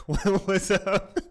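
slow, high greeting broken by laughter; laughing awkward hey. Retold or imitated. Boy laughing while greeting the hot girl slowly. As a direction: act out the cringe hello while cracking up.

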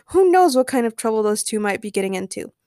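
ordinary delivery, nothing marked

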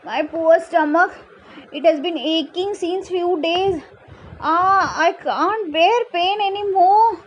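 high, pained storybook wail; groaning pitcher. A narrator's reading. Pitcher complains of stomach pain, high pained groaning. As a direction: groan and whine with a bellyache. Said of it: "the pitcher groaned".

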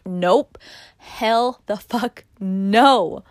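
loud, slow, punched-out no; forceful, drawn-out refusal; emphatic refusal. Retold or imitated. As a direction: shut the thought down hard, stretching each word. Refusing her own negative self-talk.

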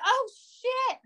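high-pitched imitated dismay; mock realization. Imagining the girlfriend realizing why she doesn't love him, mock dismay. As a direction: act out a sudden uh-oh moment.

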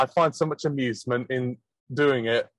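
ordinary talk

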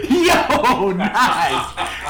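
show intro hype. Acted.

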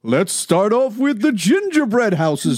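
jolly slow Santa with a laugh. Pretend. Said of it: "the Santa Claus voice".

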